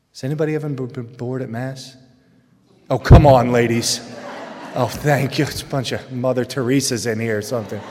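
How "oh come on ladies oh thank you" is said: loud, teasing nudge to the ladies; ribbing the room, much louder; teasing call out. Real, not acted. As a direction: call out playfully to the crowd. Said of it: "teasing the congregation".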